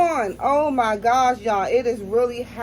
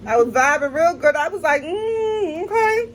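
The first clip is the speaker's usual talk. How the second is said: laugh-crying; 7 semitones higher than usual. Real, not acted. Retelling while mouth burns.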